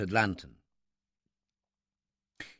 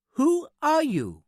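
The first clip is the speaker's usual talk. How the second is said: very high, slow, precise challenge; countering little man; 14 semitones higher, 3 dB louder, slower than usual. A narrator's reading. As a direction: throw the question back high and slow. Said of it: "the little man countered".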